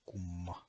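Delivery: kumma with a held m; kumma, pitkä m; long Finnish m. Shown as a demonstration. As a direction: hum the mm in kumma. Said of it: "with a long M sound".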